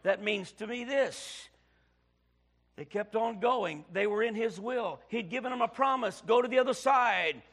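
rising full-force preacher delivery; preaching. Acted. Preacher rousing his congregation, full force. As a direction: build the sermon to a loud peak. Opening sermon, preaching hard.

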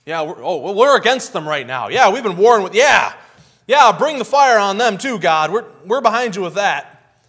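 loud, high approval after a hesitation; cheering crowd. Retold or imitated. Israelites urging judgment on Judah, loud and eager. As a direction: cheer the fire on them too.

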